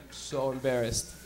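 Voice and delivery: low, slow, soft drone; imitated principal. Principal heard as student tunes in, low slow drone. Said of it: "she'd be talking".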